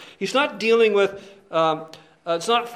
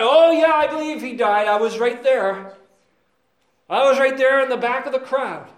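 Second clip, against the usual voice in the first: eager, high smooth-talking devil; smug devil; 5 semitones higher, 6 dB louder than usual. Pretend. Devil answering the brothers, eager and smug. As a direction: play the devil selling himself. Devil boasts he was there.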